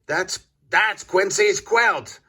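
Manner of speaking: high, squawking duck cry; excited duck quack. A narrator's reading. Dottie the duck spots the thief, high squawking cry. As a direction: sputter it out in alarm. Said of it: "quacks Dottie".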